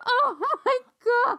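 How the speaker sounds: very high, loud laughing squeal; helpless laughter. Real, not acted. Looking at the meme again, helpless laughter. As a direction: squeal it through real laughter.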